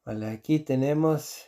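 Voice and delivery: ordinary delivery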